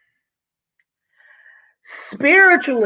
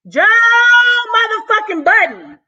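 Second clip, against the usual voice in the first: hyped shout-out; 12 semitones higher, 5 dB louder than usual. Real, not acted.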